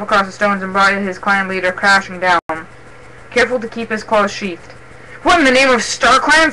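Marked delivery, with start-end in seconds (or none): 5.2-6.5 indignant, raised voice; mildly heated outburst; spat in anger. A narrator's reading. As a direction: spit out an outraged question. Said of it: "spat Mothpelt".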